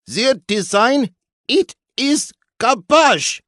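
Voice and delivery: put-on high, slow, heavily accented Italian-French maestro. A narrator's reading. Maestro scorning the rival park's design, thick accent. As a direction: sneer it in a thick foreign accent. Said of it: "He put a strong accent on the second syllable".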